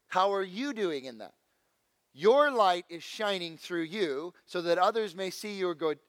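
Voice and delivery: ordinary delivery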